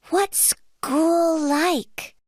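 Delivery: high, slow, curious cub question; little bear cub. A narrator's reading. Little bear asks Mom about school, high slow cub voice. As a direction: ask it slowly in a high little voice.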